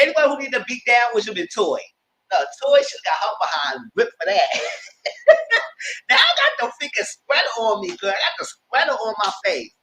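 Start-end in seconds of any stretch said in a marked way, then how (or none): 4.5-6.0 high unvoiced laughing burst; high burst of laughter. Real, not acted. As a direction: let a laugh break out high. Laughing at the scene.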